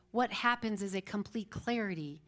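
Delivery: usual speaking voice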